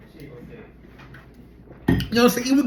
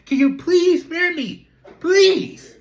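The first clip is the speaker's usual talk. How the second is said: mock begging; 9 semitones higher, much slower than usual. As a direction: beg for mercy, slow, pitch climbing. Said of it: "And I say".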